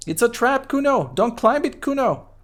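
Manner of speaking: put-on high panicky kid voice; Kuno voice. Kid Kuno warns himself off the ladder, high panicky kid voice. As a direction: yelp it up high like a scared brat. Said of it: "What is wrong with your high voice?".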